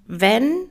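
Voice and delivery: "wenn" drawn out with a rising tone; a weighty, conditional "wenn", lifted at the end; stress on WENN. Real, not acted. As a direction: lean hard on "wenn" and let it hang. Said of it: "da liegt natürlich die Betonung auf wenn".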